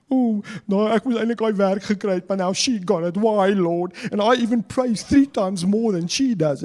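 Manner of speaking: mock sulky complaint to God; a hint of a petulant sulker; sulky whining self. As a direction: whine like a jealous churchgoer. Acting out the sulking ego.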